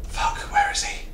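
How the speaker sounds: whispered, fearful question; nervous whisper. Real, not acted. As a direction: whisper it while hiding. Hiding from the monster in game.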